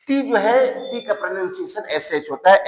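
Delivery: usual speaking voice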